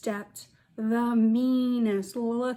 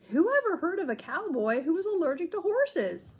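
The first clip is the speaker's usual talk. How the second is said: put-on a touch of kid's dismay, pitched up; lightly voiced boy, dismayed; 8 semitones higher than usual. Avery doubting himself at camp, mildly dismayed. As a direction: wonder aloud like a let-down little boy. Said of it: "he thought".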